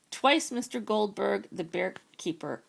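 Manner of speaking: ordinary delivery, nothing marked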